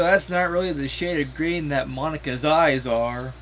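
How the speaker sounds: ordinary talk, nothing marked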